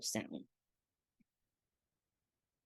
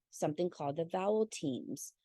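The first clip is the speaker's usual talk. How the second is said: ordinary delivery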